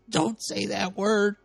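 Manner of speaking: high, slow whiny plea; Watt's voice. Retold or imitated. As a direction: squeak it out slowly as Watt. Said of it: "Watt says".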